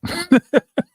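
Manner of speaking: high-pitched real giggle; laugh. Real, not acted. Host defending how they talk, giggling high. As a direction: break into a high chuckle. Amused after defending own speech.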